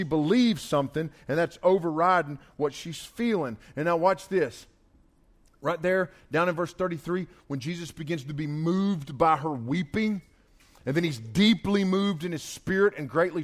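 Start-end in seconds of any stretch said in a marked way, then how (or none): none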